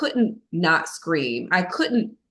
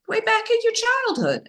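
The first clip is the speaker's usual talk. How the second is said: Furia's answer. Retold or imitated. Voicing Furia, her anger.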